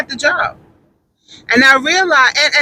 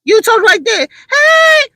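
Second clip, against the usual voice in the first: put-on sister girl; 10 semitones higher, 3 dB louder than usual. Shown as a demonstration. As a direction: show off how a sister girl talks. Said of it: "that is sister girl".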